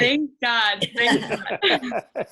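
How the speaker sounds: laughing relief; relieved laughter through the words. Real, not acted. Relieved reply to a joke.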